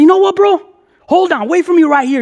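high-pitched mock fuming; hammed-up hot-headed resolve; faked gangster anger. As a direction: put on a worked-up tough act. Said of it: "put in my old gangster face, all mad dogging".

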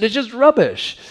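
high-pitched mock English accent; badly faked English accent, pitched well up; terrible English accent. Preacher repeating the album insult after admitting it's terrible, English accent. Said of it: "That's a terrible English accent".

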